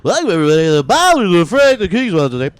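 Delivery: loud high radio host. Pretend. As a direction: announce it like a DJ. Mock radio show intro.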